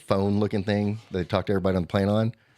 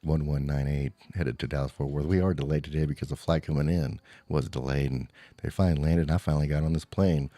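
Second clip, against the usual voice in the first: airline pilot announcement; 7 semitones lower, 4 dB quieter than usual. Retold or imitated. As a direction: lower monotone pilot voice. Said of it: "real monotone".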